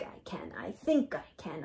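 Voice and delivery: effortful whispered mantra. A narrator's reading. Straining engine whispers.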